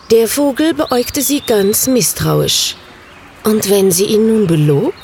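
usual speaking voice

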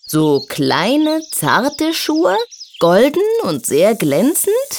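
drawn-up, high questioning lilts; teasing snake question. A narrator's reading. Snake asks the fairies about the shoes, teasing. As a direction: let each description swing up into a question.

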